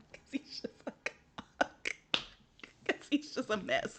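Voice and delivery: breathless unvoiced laugh-talk. Real, not acted. Vlogger laughing about the boy, wheezing.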